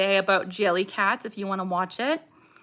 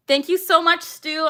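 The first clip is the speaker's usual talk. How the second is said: a higher, louder thank-you with a laugh; excited thanks; 7 semitones higher, 4 dB louder than usual. Real, not acted. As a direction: squeal out the thanks. Thanking a viewer for donation.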